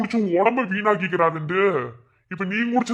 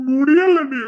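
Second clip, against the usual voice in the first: sobbing cry, higher and louder; 6 semitones higher, 7 dB louder, slower than usual. Acted. Drunk young man to his brother, wailing in tears. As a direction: breaks down wailing that he can't take it.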